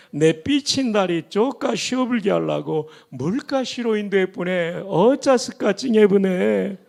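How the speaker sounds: 전라도 사투리로 신나게; Jeolla dialect. Shown as a demonstration. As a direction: tell it like a Jeolla in-law, full of relish. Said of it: "전라도 버전입니다".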